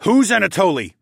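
high, loud, urgent question; forceful, urgent interrogation; demanding. A narrator's reading. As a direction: demand an answer under attack. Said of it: "I demanded".

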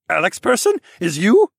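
very high, loud squeaky creature voice; pigeon-speak voice. Acted. Pigeon-like steed calls to Alex through their mind, high and squeaky. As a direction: chirp it out like a little pigeon creature. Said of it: "Prosper's pigeon-speak voice".

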